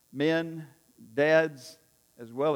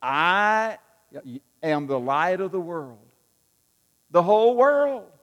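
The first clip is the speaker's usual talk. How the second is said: leading the congregation; 2 semitones higher, 7 dB louder than usual. Shown as a demonstration. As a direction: call it out for everyone to echo. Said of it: "Come on, say this".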